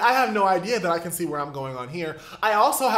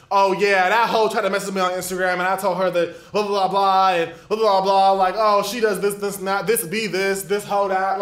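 put-on louder, brash gossip about a girl; driver gossiping; 2 semitones higher, 5 dB louder than usual. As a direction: mimic the driver ranting on the phone, loud. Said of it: "he's saying stuff like".

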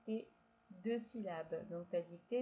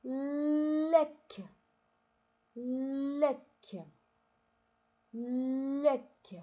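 louder, higher, clear dictation of lec; 3 semitones higher, 6 dB louder, much slower than usual. Shown as a demonstration. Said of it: "Écris". (dictée forte et articulée de lec)

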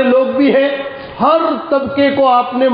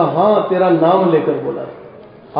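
tender reassurance; 8 semitones lower than usual. Retold or imitated. Prophet reassures weeping Ubayy.

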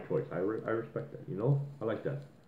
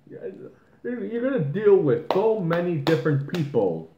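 normal talking voice

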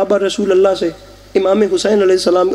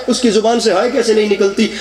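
ordinary talk, nothing marked

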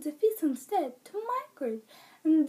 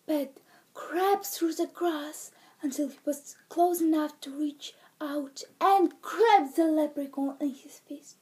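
ordinary delivery

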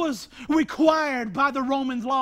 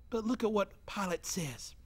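whisper; 5 semitones lower, 10 dB quieter than usual. Real, not acted. Preacher drops voice dramatically.

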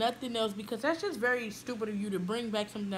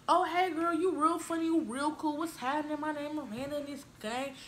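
energetic greeting; 6 semitones higher than usual. Shown as a demonstration. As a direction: greet new friends with bubbly energy. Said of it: "Be, be like".

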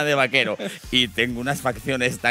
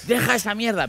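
father's scold; 8 semitones higher than usual. Retold or imitated. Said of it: "Decía".

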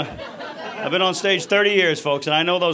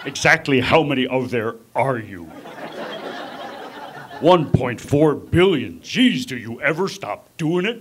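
lower, slow wrestler-president bluster; brash tough guy talking down to a diplomat; Jesse Ventura; 4 semitones lower, slower than usual. Retold or imitated. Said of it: "President Ventura, here's the premier".

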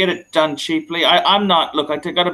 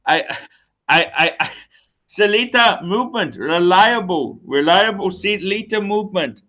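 hammed-up Indian accent, higher and louder; excitable mock-Indian sales pitch; 3 semitones higher, 4 dB louder than usual. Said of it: "in your best Indian accent".

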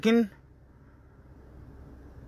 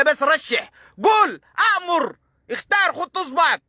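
mock-urging caller, high-pitched insistent orders. Callers press him to pick names, pushy high-voiced mimicry.

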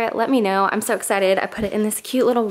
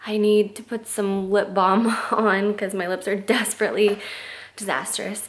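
ordinary talk, nothing marked

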